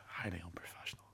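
hushed whispered aside; whispering. Real, not acted. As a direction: whisper it to yourself. Joking self-criticism, whispered aside.

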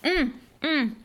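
humming through a mouthful; chewing while talking. Real, not acted. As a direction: mouth full of food. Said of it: "I'm also eating dinner".

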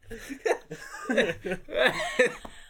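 helpless laughing fit, almost crying. Real, not acted. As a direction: laugh until it nearly turns to tears. Laughing fit after joke.

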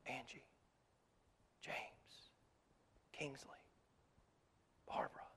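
soft breathy whisper of each name. Shown as a demonstration. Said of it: "He's whispering your name".

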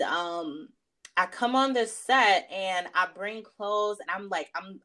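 ordinary talk

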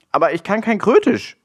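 high, helpless protest; desperate. A narrator's reading. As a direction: object in mild despair. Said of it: "entgegnete Willi verzweifelt".

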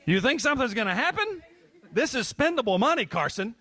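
high-pitched, laughing disbelief; mock incredulous. Real, not acted. Teasing a hesitant child.

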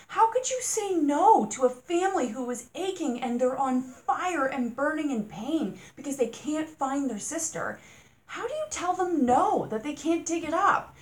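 loud, exasperated rant; indignant outburst at the property owner. Real, not acted. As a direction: pour out the frustration loudly. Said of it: "Didn't mean to rant".

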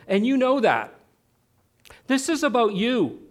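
re-enacted marital rant, building from measured to heated; accusing tone. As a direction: lay into her with mounting, emphatic accusation. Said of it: "I said it in that tone of voice".

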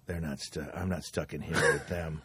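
very low, hushed movie quote; quiet menace. Retold or imitated. Retelling prisoner's threat to inmates, quietly menacing. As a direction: hold it right down before the punchline. Setting up the shouted movie punchline.

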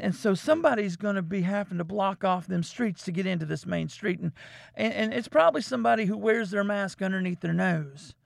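ordinary delivery

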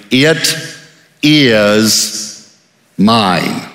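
each word set apart with pauses; it, is, mine spaced out and falling; slow emphatic words. Shown as a demonstration. Preacher to congregation, each word spaced out. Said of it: "now watch these three words carefully".

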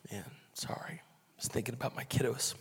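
whispered through tears, barely holding it together; a broken whisper, fighting back tears; choked up. Real, not acted. Pastor apologizing to the church, choked up and whispering.

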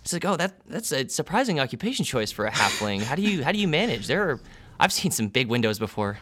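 the bubbly desk clerk greeting new arrivals; receptionist woman. Acted. GM as receptionist welcoming the test subjects, bright and chirpy.

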